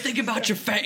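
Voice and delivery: hammy high Australian jibe; awful Australian accent. Retold or imitated. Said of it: "in, in the accent".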